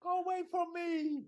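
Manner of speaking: high-pitched mock Italian accent. Italian criminal fends off the policeman, high hammed-up Italian accent. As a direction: ham up an Italian suspect resisting. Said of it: "the accent is something else".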